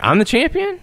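high, rising disbelief; disbelieving Asuka. Retold or imitated. Asuka asking Becky if she really won, high disbelief. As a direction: ask it high, unable to believe it. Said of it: "she comes back and is like".

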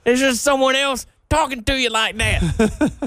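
high, hammed-up country twang; mock country accent. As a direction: tease in a put-on country voice. Continuing the mocked country accent.

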